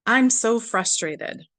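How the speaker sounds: steady tapping affirmation voice; slow affirmation. Shown as a demonstration. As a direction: say it deliberately as you tap. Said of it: "Now top of the head".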